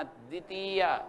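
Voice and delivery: द्वितीया with a long, held ती. Shown as a demonstration. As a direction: draw out the ती in द्वितीया. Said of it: "ती को बड़ा बोलना".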